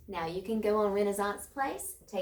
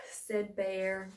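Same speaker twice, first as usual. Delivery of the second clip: ordinary delivery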